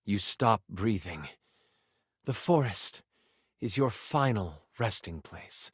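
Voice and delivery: low, slow, hushed narrator; grave death narration. A narrator's reading. Narrator tells the player they have died, grave and hushed. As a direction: let it sink low and slow, with pauses.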